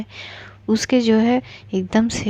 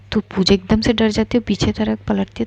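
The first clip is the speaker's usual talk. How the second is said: plain reading voice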